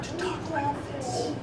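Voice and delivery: lightly hammed-up pious hush; mock solemn voice. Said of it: "they get very solemn".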